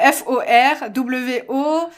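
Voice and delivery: slow, careful letter-by-letter spelling. Real, not acted. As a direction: spell each letter slowly and clearly. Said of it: "donc je vais vous l'épi".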